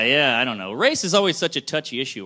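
normal talking voice

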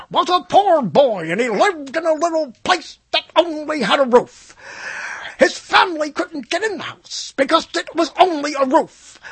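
high, overwrought storytelling; hammed-up sob story. Host reading the company founder's life story, mock melodrama. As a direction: declaim a tear-jerking biography, voice pushed way up.

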